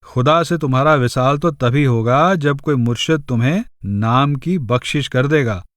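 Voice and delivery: ordinary delivery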